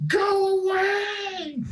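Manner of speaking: high, slow scream; screaming woman. Acted. GM voicing the woman screaming through the door, shrieking. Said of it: "You hear her scream out".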